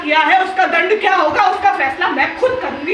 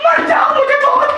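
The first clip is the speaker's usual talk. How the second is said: panicked pleading; 9 semitones higher than usual. Acted. Children leaving her alone.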